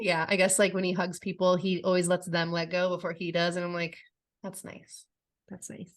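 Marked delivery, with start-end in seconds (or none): 4.4-5.9 very soft, near-whispered aside; tiny, hushed murmur; hushed reaction. Retold or imitated. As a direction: say it under your breath. Quiet touched reaction to hug detail.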